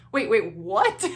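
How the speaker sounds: loud double take, leaping up on "what"; mock a stunned listener's double take; baffled surprise. People hearing the twin news, baffled surprise.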